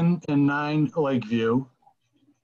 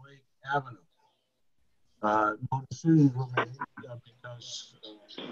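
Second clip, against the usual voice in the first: audio breaking up on a bad line; words dropping out over a choppy connection; 6 dB quieter, much faster than usual. Real, not acted. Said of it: "Norm, you're breaking up".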